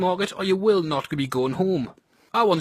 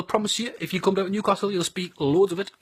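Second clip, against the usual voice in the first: ordinary talk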